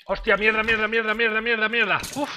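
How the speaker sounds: high, loud panicked cursing; panicked shouting. Real, not acted. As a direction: yell in sudden fright. Enemy suddenly attacks.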